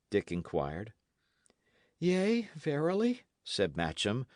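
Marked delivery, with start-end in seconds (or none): 2.0-3.2 high, light assent; a hint of a boyish character voice; lightly voiced youth. Matcham answering his friend, lightly voiced and high.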